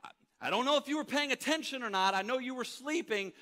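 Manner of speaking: high, loud, fast indignant retelling; animated protest. Pretend. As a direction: argue back, all worked up. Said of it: "my response to Jesus would be like".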